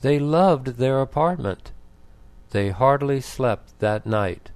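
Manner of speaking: ordinary delivery, nothing marked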